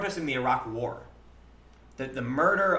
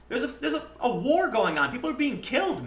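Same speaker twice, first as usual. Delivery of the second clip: high, incredulous half-laughing rant; rising disbelief with a laugh; high pitched exasperation, half laughing; exasperated outburst; 11 semitones higher, 4 dB louder than usual. Retold or imitated. Exasperated rant about the war.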